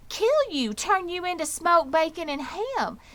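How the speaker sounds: put-on old sheep relishing. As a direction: spell out his doom with relish.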